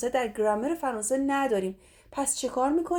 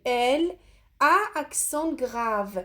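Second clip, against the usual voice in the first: slow dictation; much slower than usual. Shown as a demonstration. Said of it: "teacher spelling out a letter".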